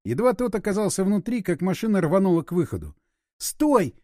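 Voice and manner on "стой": high, urgent cry to halt; wailing cry. A narrator's reading. Irina begs the driver to stop, wailing. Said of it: "взвыла Ирина".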